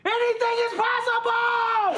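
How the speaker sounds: high, soaring holler; catchphrase yell. Acted. Host cueing the crew with the catchphrase, high shout. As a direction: belt it high as a big cue.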